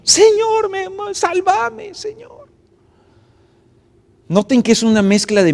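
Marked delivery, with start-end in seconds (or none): 0.0-2.5 high, desperate plea from someone sinking; frightened cry for help. Acted. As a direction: cry out to the Lord in fear, high and pleading. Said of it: "Empezó a gritar, miedo".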